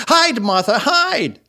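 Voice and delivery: high, urgent call to hide; excited children's cry. A narrator's reading. Young Cratchits urging Martha to hide, excited high cry. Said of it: "cried the two young Cratchits".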